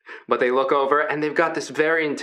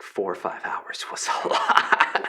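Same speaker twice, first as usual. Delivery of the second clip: laughing whisper; 5 semitones lower than usual. Real, not acted. Laughing aside.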